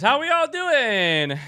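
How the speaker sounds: high, loud, drawn-out hail to the crowd; big slow streamer shout; called-out greeting. Real, not acted. Streamer calls out greeting to chat.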